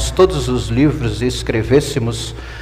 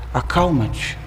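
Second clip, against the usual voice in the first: soft, hushed command to the storm; calming command; 6 dB quieter, slower than usual. Acted. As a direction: quietly still the waves. Master stills sea and wind.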